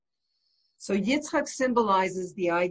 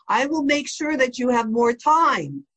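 a raised, stern boss's warning, higher and louder; boss's threat; 5 semitones higher, 5 dB louder than usual. Retold or imitated. Company boss warning a busy employee, raised and stern. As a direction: lean in hard on "time" as a firm threat.

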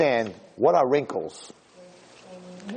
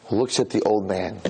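ordinary talk

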